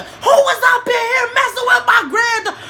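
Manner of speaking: furious grandma confronting the room; grandma storming in, yelling; grandma going off. Retold or imitated. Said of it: "My grandma went off".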